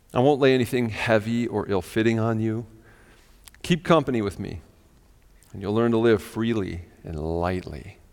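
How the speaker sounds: low, measured reading with long pauses; slow reading. Real, not acted. Preacher finishes reading the paraphrase to the congregation, slowly. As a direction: let each phrase settle before the next. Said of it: "I'm gonna read it slow again".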